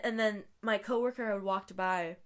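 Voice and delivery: normal talking voice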